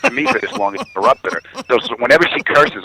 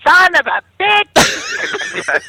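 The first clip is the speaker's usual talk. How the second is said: very high, drawn-out shriek of a curse; the wife yelling from upstairs at the game; wife swearing upstairs; much slower than usual. Retold or imitated. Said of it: "I'll hear her upstairs going".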